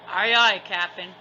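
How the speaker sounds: loud pirate crewman answer; pirate crew reply. A narrator's reading. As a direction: sing out to the captain. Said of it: "they answered".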